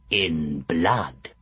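low, ominous witch; menacing old hag. Acted. Hag demands a blood oath.

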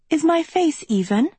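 a vain princess fussing; prissy princess voice. A narrator's reading.

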